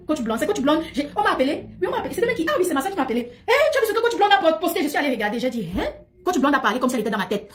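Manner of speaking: ordinary talk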